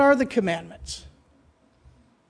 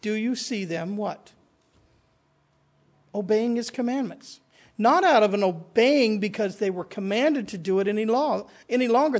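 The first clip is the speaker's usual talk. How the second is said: normal talking voice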